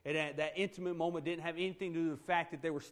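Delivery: ordinary talk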